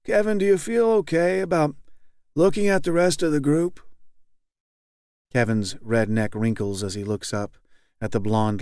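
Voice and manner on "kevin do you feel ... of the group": high, blandly kind leader. A narrator's reading. Group leader gently affirms weeping Kevin, high and blandly kind. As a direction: soothe him like a patient therapist talking to a child. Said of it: "very blandly and kindly".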